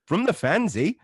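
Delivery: put-on Canadian accent. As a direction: read the fan's note like a cheerful Canadian. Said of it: "I can't do the voice for too long".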